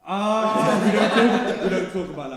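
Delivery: loud, high protest through laughter; laughing outcry. Real, not acted. Friend recoiling from an embarrassing shared memory, laughing outcry. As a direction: cry out in laughing refusal.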